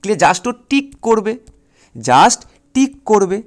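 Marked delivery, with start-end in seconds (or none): none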